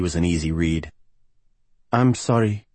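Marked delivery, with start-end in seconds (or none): 1.9-2.7 higher-pitched woman's role voice; Gabriella. Acted. Cheerless singer apologizes to Rick, higher regretful voice. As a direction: apologize in a raised, put-on woman's voice.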